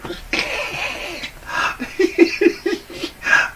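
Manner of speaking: breathy rising high laugh. Real, not acted. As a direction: laugh high at your own dare. Laughing at own dare.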